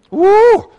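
high loud woo; joyful whoop. Real, not acted. Preacher whoops to the church over healing. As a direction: let out a celebration whoop.

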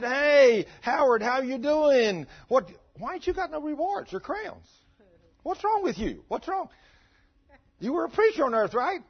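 very high, loud, incredulous questioning; a friend in heaven baffled by the missing crowns, high and loud; astonished friend. Retold or imitated. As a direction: call out to him like a shocked old friend. Friend greets Howard in heaven.

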